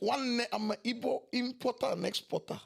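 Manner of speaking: hammed-up Igbo accent; a mock Igbo accent attempt.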